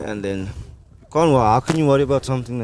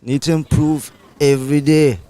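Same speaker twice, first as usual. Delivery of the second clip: put-on Caribbean lilt; easygoing West Indian voice; West Indian accent; 4 semitones higher than usual. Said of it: "very good West Indian accent".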